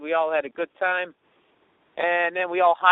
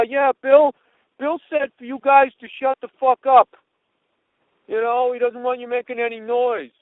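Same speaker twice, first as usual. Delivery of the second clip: raised voice, aggrieved retelling; higher, louder put-on complaint; upset fan; 4 semitones higher, 5 dB louder than usual. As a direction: imitate an offended fan reporting what he was told. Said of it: "he's all upset".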